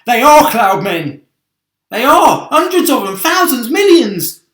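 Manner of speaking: very loud high panicked shouting. Acted. Travellers seeing the cloud men, loud panicked shouting. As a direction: scream in mounting panic.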